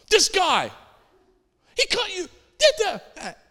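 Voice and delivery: high-pitched, outraged squawk of a backseat critic; indignant passenger. Retold or imitated. Passenger fuming at another driver to the preacher, high mock indignation.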